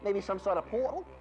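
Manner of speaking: high-pitched fake Aussie; hammed-up Australian caricature; ridiculous Australian accent. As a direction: greet in a badly faked Australian accent. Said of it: "your accent sounds completely ridiculous".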